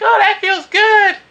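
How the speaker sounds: mock her in a high giggly voice; girly voice. The Japanese girl agreeing they're a good team, in a high squeaky voice. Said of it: "She's like".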